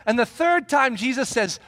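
ordinary talk, nothing marked

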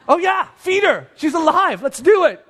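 high, eager scrambling reply; acted onlookers jumping to it; flustered excitement. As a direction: burst out as if suddenly remembering. Onlookers jump into action.

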